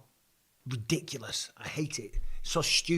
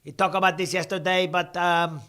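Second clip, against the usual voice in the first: higher, louder mock Canadian accent; put-on Canadian accent; 3 semitones higher, 5 dB louder than usual. Streamer answering a chat jibe about the accent, mock Canadian accent. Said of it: "not impressed with that accent".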